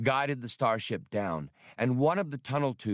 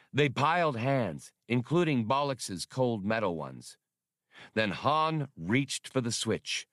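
plain reading voice

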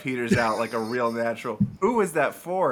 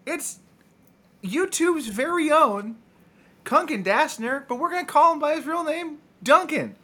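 announcer intro; 11 semitones higher than usual. Acted. Introducing guest Duncan to the audience, hammed-up announcer voice. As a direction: sell the guest like a game show host.

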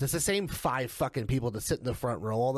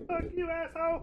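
high, strained mock scream at held-back volume; imitated yell; 3 dB quieter than usual. Co-host imitating the promo amid yelling, high mock yell. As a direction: squeal the insult as a mock yell. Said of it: "everyone's just yelling".

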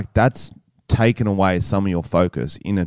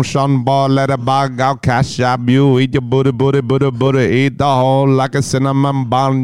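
higher Jamaican dancehall [name] voice, Jamaican patter in a rush; [name] impression; 4 semitones higher than usual. Shown as a demonstration. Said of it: "if you read it in his voice".

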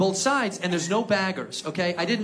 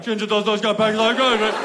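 fast mock British chorus; jumbled, quick British jeering; British crowd; 3 dB louder, faster than usual. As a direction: imitate a whole British store piling on. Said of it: "the whole store is like".

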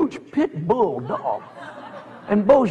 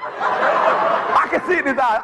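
normal talking voice

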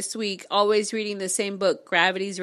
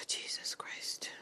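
breathy whispered Jesus Christ under the breath; whispered exasperation; slower than usual. Real, not acted. Reader whispering in disbelief at a message. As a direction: mutter it to yourself in a whisper. Exasperated aside while reading.

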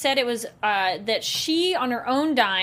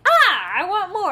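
high, loud greedy whine; whiny wanting; 7 semitones higher, 5 dB louder than usual. Retold or imitated. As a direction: beg for more like a kid. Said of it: "I'm like".

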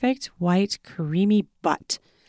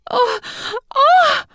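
high, loud startled oh; role-playing stepmother gasps, much higher and louder; 4 dB louder than usual. A narrator's reading. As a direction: gasp in mock shock. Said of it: "she gasps".

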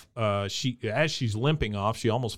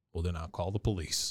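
deadpan; 6 semitones lower, 8 dB quieter, faster than usual. Retold or imitated. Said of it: "And then Bill says".

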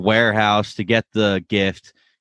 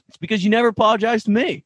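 quick, higher outburst as Ted; imitating Ted; 12 semitones higher, much faster than usual. Recapping Ted finally snapping at Lily, high quick outburst. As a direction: blurt it fast and high. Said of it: "Ted finally hits him".

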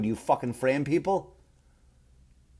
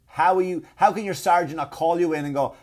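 ordinary talk, nothing marked

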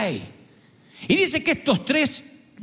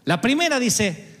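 usual speaking voice

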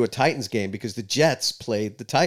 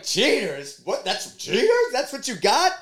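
mock indignant; 11 semitones higher, slower than usual. His retort to taunting fans.

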